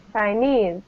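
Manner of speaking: "Chinese" with the stress on "nese"; stress on second syllable. Shown as a demonstration. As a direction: lean on the final syllable of "Chinese", falling at the end. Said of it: "The second syllable".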